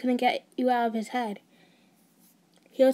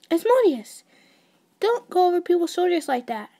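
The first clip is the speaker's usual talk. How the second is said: higher, louder flustered snap; startled scolding; 6 semitones higher, 6 dB louder than usual. Acted. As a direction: caught off guard, scold him. Startled at being watched.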